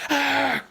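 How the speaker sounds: high, loud scream of dismay. Acted. Barley reaching into his pocket, screamed. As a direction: let out a sudden high shriek. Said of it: "he screamed".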